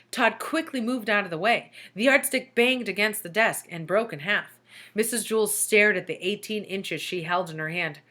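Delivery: plain reading voice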